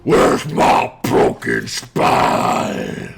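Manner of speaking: hammed-up cranky demand, low and very drawn out. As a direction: growl it slowly like a grumpy coffee addict.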